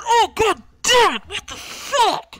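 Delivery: high, loud, slow pained yelp; pained auctioneer yelp. Acted. GM as the punched auctioneer, a high loud yelp. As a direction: yelp it out like a man just punched in the nose. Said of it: "And he goes".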